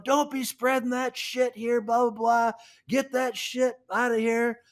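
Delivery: high mock yell at the farmer; retold screaming. Angry neighbor yells at the farmer spreading manure, high mock yell. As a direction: shout him off your land, pitched high. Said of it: "screaming up and down".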